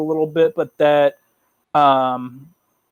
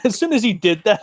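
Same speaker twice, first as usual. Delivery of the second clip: ordinary delivery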